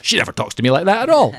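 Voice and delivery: mock husband. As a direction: play the surprised husband for laughs. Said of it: "And then he goes".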